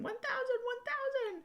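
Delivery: high-pitched mock scream at ordinary volume; imitated excited screaming. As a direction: squeal it like an overexcited kid shouting from another room. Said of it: "just screaming and shouting".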